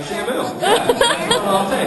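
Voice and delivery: put-on high, eager little-kid voice; chirpy, thrilled childlike pick; excited kid. Kid choosing job from list.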